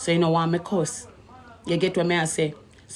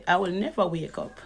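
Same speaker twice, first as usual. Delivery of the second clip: laughing aside; 8 dB quieter than usual. Real, not acted. Woman scoffing that she will never wake up, laughing quietly.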